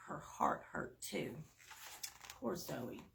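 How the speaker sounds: soft, sad whisper; hushed sadness. Acted. Reader softly sharing Zoe's sadness, whispered. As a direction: let it sink in softly. Empathetic storytelling.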